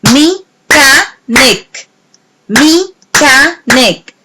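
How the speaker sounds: slow, each beat apart: Me-cha-nic; counted syllables. Shown as a demonstration. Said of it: "Let's count them".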